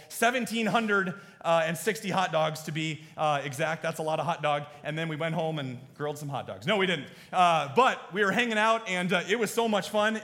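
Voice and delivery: ordinary talk, nothing marked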